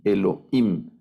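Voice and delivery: Elohim with stress on final -him; acento en la última. Shown as a demonstration. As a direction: repeat Elohim, landing on its last syllable. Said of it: "sino Elohim". (Elohim, con el acento en la última sílaba)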